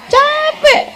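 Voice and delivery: high laughing "Capek"; laughing answer. Real, not acted. Preacher laughing as she answers the congregation. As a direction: toss it off with a laugh.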